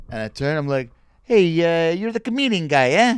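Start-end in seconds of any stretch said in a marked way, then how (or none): 1.2-3.1 loud, high Italian heckler; raised-pitch Italian accent, calling out; Italian stranger. Retold or imitated. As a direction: imitate an eager Italian fan. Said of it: "being interrupted by two Italians".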